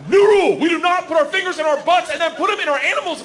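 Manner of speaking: high, loud laying down the law; stern shout. Acted. Dad announcing a rule to his kids, loud and stern. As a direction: bark out the new house rule.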